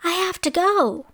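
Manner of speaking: high-pitched, urgent storybook cry. A narrator's reading. Cinderella must leave the prince, crying out. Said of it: "she cried".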